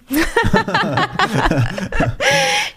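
high rising laugh; bright laugh. Real, not acted. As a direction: burst into a real laugh. Laughs at playground joke.

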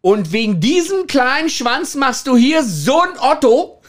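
mock a woman jeering down from the stairs; mock yell from the stairs. Woman on the stairs jeering at the caught man, mock yell. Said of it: "brüllte eine".

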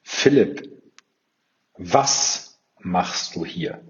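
a drawn-out, quiet wondering with weight on „machst“; stress on machst, slow. Shown as a demonstration. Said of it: "Du musst halt einfach nur die Betonung auf andere Wörter legen". („Philipp“ hoch, dann langsam und leiser mit Betonung auf „machst“)

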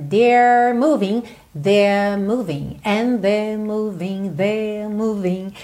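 "they're" stretched long with no curled R; lengthened British vowel. Shown as a demonstration. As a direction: slowly draw out "they're", tongue uncurled. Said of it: "alonga, mas não enrola a língua, no sotaque britânico". ("they're" alongado, sem R, sotaque britânico)

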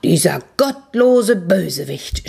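slow, emphatic indignation; measured storyteller's outrage; angry outcry, restrained. A narrator's reading. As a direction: condemn him slowly, word by word. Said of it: "schrie der Schneider".

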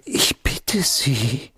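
slow whispered dismissal. Acted. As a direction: brush it off in a hush. Abwiegeln einer Drohungsfrage. (langsam geflüsterte Abwehr)

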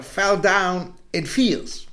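ordinary delivery, nothing marked

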